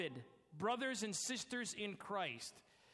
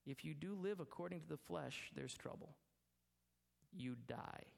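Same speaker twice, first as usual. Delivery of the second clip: hushed warning; 5 semitones lower, 7 dB quieter than usual. Acted. Hushed warning about living by flesh.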